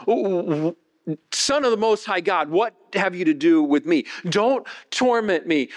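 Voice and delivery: imitated plea, high-pitched and drawn out; lightly voiced demoniac. Possessed man falls before Jesus, lightly acted high pleading. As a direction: lightly act a desperate, slow appeal. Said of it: "falls down in front of Jesus and says".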